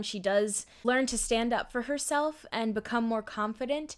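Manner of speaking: ordinary talk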